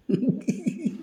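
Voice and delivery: a soft, high-pitched giggle. Real, not acted. Laughs softly at the pet.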